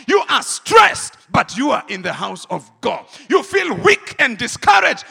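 loud shouted sermon peaks; fiery refrain, shouted then dropped; full-force preaching. Acted. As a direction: hammer each line at the church.

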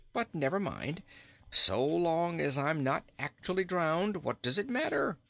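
high-pitched, rambling storybook king; good-humoured king. Acted. King makes light of his soaking, high and breezy. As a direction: shrug it off in a high king's voice.

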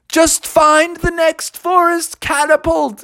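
high owl character voice; high-pitched owl coaching; breezy owl. A narrator's reading. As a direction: call instructions like a zany bird. Owl instructs the bear.

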